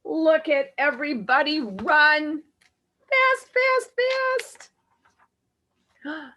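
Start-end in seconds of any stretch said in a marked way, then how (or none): 3.1-6.3 high and quick, urging; urgent. A narrator's reading. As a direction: hurry them along. Everybody runs away.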